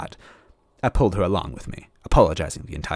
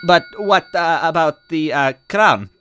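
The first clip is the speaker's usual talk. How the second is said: higher, louder, halting stammer; nervous stutter; 10 semitones higher, 6 dB louder than usual. Acted. Luigi nervously stammering to change the subject. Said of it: "my stupid stutter".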